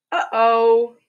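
a cartoonish oops for the kids; playful uh-oh. Refrain voiced by book character.

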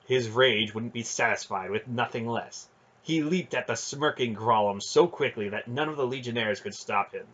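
plain reading voice

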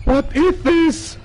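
high, slow, loud sneering quote; mock game voice. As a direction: mimic the game's taunt. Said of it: "Bei Daylight wird's jetzt wieder sagen".

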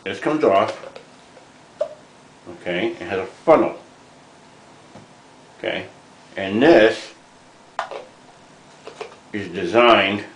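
talking around a cigar; cigar in mouth. Real, not acted. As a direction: lips clamped on cigar. Seen 2: Cigar clamped between lips throughout while he handles the wooden container.

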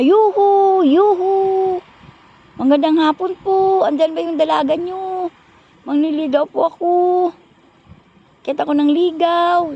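high, loud calls up from below the house; hollering up to the house for the girl; loud calling out. Retold or imitated. Suitor calling loudly from downstairs to court the girl. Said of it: "Ang lakas ng boses niya".

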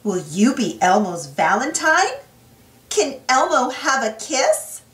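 ordinary delivery, nothing marked

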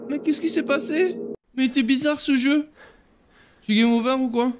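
put-on high, frightened child crying out to mum; a small child calling for mum in a bad dream; panicked little kid. Child in a nightmare calling out to mum, high and scared.

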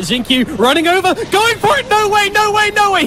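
high, disbelieving no way calls; excited. Real, not acted. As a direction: call the steal attempt in disbelief. Second Aegis steal attempt.

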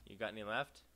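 usual speaking voice

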